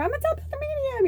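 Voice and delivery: very high, fast self-parody; overeager announcement. Retold or imitated. Parodying how she once introduced herself to people, overeagerly. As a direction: squeal it out in a rush.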